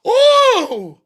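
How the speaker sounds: high, loud fake crying cry; mock wail. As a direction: wail like a baby, hamming it up. Mocking the caller's hurt feelings.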